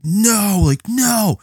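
high, loud, anguished cry of no; yelled no. Retold or imitated. Fan in the theater yelling at the screen, high and loud. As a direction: cry out no as the hero falls. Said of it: "I'm like".

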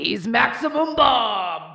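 slow booming announcer shout; dramatic announcer. Pretend. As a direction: announce him like a wrestler. Dramatic superhero alter ego intro.

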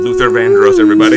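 loud arena announcer call. Pretend. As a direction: present him to the crowd, loud. Introducing arriving friend.

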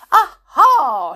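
loud gleeful discovery; excited. Acted. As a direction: pounce on it with glee. Amelia spots the telephone.

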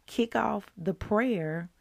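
ordinary talk

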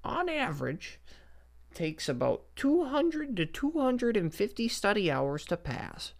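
high, slow mock old lady voice; old woman lecturing. Mocking the old exam lecturer in a high old-lady voice. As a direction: parody her slow lecturing tone. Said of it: "she's like".

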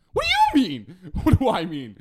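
high, loud disbelieving yell; laughing mock outrage; incredulous shout. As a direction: throw the question back in disbelief. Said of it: "And I was just like".

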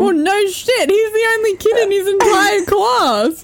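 high-pitched, laughing scoff; scornful disbelief. Real, not acted. Scoffing at obvious lack of experience.

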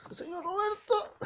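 very high squeaked name; high cartoonish squeak; squeaky mock voice. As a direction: say it like a silly squeak.

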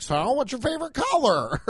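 high, loud, fake-bright question; sidestepping. Dodging his date's camping talk, high chirpy deflection. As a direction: chirp it to dodge the topic. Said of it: "I just sidestep it like".

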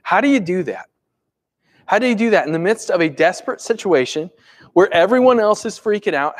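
ordinary delivery

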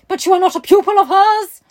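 high, loud hammed-up demand; agitated questioning. A narrator's reading. Gentleman presses Sarah about Miss Minchin's school, loud agitated question. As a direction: shout the question in disbelief.

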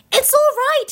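high, loud storybook call; acted hearty shout. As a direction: call out to people hidden some way off. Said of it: "he shouted".

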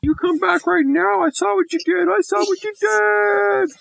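very high squawky parrot voice; squawking parrot. Acted. Storyteller as the parrot, calling after the girls. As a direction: call out like a tattling parrot. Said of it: "the parrot called".

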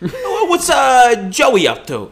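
put-on high, loud, absent-minded old dad; vaguely forgetful dad; dad impression. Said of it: "Zach's dad".

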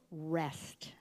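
soft whispered final word; whispered rest. Acted. Closing the psalm for the church, whispered. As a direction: let the word fade to a whisper.

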